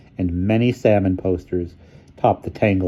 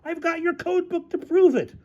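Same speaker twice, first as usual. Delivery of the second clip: very high-pitched insistence; high, emphatic quarrel voice; outraged accusation; slower than usual. Acted. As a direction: squeal it like an incensed accuser. Shouting match continues with accusation.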